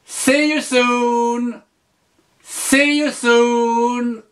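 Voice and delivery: slow, high teacher model; slow model phrase. Shown as a demonstration. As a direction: say it slowly and brightly for the learner. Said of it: "tu peux dire".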